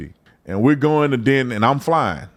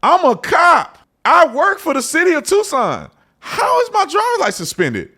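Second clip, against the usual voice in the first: shocked disbelief. Retold or imitated. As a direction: squeal it in total disbelief. Shocked outburst at suspended license.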